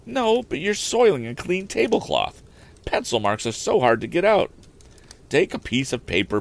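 a prim housekeeper protecting her tablecloth; fussy housekeeper. Acted. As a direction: housekeeper scolding the inventor, high-pitched. Housekeeper voiced.

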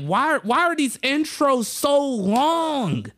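high, drawn-out exasperated complaint; mock outraged roast. Comic roasting the host's intros, exaggerated exasperation. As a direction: stretch the words slowly, pitch way up. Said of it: "started going in on 'em".

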